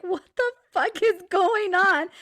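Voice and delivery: high, loud, drawn-out disbelief; imitated bewilderment. Friend blindsided by a fake pregnancy claim, imitated bewilderment. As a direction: imitate a stunned friend, stretching it out slowly. Friend's shocked reaction, acted out.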